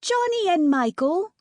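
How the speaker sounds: high put-on British accent; hammed-up British accent. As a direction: play Wendy in a posh British accent. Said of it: "in my best British accent".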